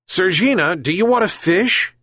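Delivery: high-pitched coaxing question to a cat; coaxing call. A narrator's reading. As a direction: lure the cat with an offer. Old man coaxes the cat.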